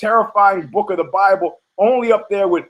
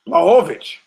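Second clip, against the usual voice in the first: ordinary talk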